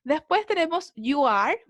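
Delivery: ordinary talk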